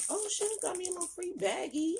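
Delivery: high-pitched, delighted squeal; delighted surprise. Real, not acted. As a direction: pitch it way up, thrilled by a little freebie. Delighted at finding a free bag.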